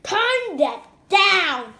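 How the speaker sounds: loud, slow, stern command; Dad yells at Henry to turn it down, loud and slow; yelling. A narrator's reading. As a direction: slowly bark the order. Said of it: "yelled Dad".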